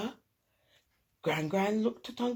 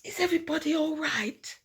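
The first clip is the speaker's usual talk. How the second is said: 1 Mum's call; 8 semitones higher, 3 dB louder, faster than usual. A narrator's reading. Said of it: "Mum said".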